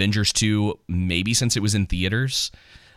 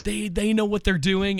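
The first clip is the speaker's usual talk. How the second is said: high, insistent fanboy pleading; past fanboy self; 11 semitones higher than usual. Retold or imitated. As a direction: plead much higher like a younger fan.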